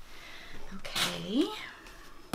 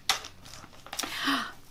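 excited gasp; 5 semitones higher, 4 dB quieter than usual. Real, not acted. Delighted at the candy.

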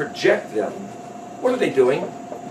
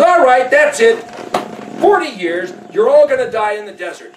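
raised, higher mock-angry sentence; fed-up outburst; 6 semitones higher, 4 dB louder than usual. Yahweh sentencing the grumbling people, angry and fed up. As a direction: lay down punishment, patience gone.

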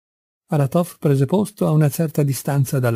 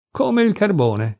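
lightly merry call, pitched high and drawn out; cheerful call; 7 semitones higher, slower than usual. A narrator's reading. Kupfer answering his friend as he rushes out, cheerful call. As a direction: call it back cheerfully, higher and slower. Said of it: "gridò allegramente Kupfer".

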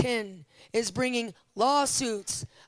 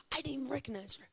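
whisper; 2 semitones higher, 10 dB quieter, much faster than usual. Real, not acted. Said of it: "aside whispered".

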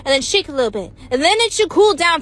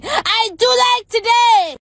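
high, loud, drawn-out cheer; triumphant shout; 7 semitones higher, 5 dB louder, slower than usual. Acted. Host pleased with cooled food, triumphant high shout. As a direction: belt it out slowly in delight. Happy twist on catchphrase.

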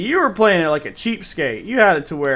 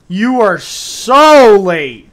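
slow, loud, high drawn-out tease; mock scolding; 4 semitones higher, 12 dB louder, much slower than usual. Real, not acted. Streamer teasing a late viewer, loud and drawn out. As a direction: stretch every word to rib him.

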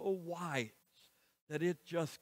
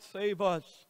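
louder, pleading cry for help; 3 semitones higher, 5 dB louder than usual. Acted. The people begging Moses to pray for them, crying out louder. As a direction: call out desperately to Moses. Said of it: "the people cried out".

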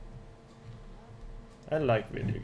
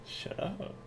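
ordinary delivery

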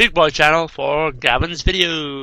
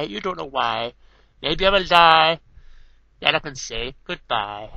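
soft, close-mic verse recital; poem reading; 5 dB quieter than usual. Acted. Reading a poem on the headset, softly recited. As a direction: read it gently like a quiet poem.

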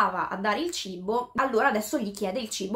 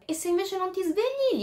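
lightly acted voice, raised pitch; mysterious voice; 9 semitones higher than usual. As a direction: put the doubt as a higher, questioning voice. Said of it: "gli dice questa voce".

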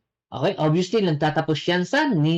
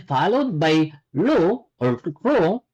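usual speaking voice

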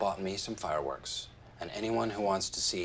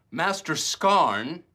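louder, higher, very slow; booming slow proclamation; 7 semitones higher, 7 dB louder, much slower than usual. Acted. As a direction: boom it out like a grand title. Dwight repeats even bigger.

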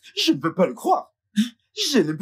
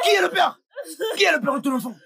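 angry demand; 12 semitones higher, faster than usual. Acted. Mother presses her daughter, shouting angrily. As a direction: hammer the question home.

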